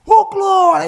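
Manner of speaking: sudden high, loud cry of glory; startled shout of praise. Retold or imitated. Startled pastor jumps and shouts praise, high and loud. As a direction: yelp it out as if startled into joy.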